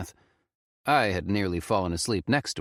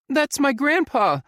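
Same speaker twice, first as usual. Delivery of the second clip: high, loud horrified cry; shocked disbelief; 8 dB louder than usual. Acted. Faye tells the narrator she hears her grandpa, horrified and high. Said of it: "she said in a horrified voice".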